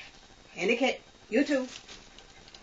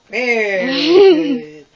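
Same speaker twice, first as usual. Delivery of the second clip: laughing praise; 3 semitones higher, 14 dB louder, much slower than usual. Real, not acted. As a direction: gush with laughter. Praising dog, laughing cry.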